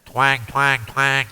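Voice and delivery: punchy mimicked guitar twangs; imitating twangy guitar. Shown as a demonstration. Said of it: "twangy sound".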